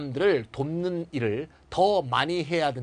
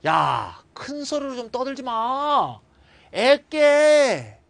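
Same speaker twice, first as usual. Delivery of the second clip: scolding shout; 6 semitones higher, 4 dB louder, much slower than usual. Acted. Acting a scolding example.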